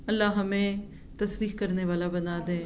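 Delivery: ordinary delivery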